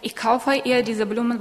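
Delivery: normal talking voice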